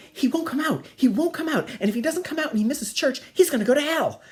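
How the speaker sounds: high, loud outraged complaint; mock hysterical mother. Mother complaining to father about son, mock hysterical. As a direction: imitate a mother at the end of her rope.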